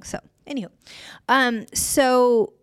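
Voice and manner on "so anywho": a hushed, throwaway anywho; whispered aside. Real, not acted. Storyteller whispering an aside to listeners before moving on. Aside after risqué joke.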